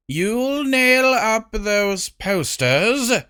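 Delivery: slow, high, imperious queen voice; haughty queen. Acted. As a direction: lay down the law, slowly and coldly. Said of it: "glancing sharply at Huncules".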